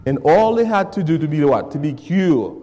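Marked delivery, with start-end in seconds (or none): none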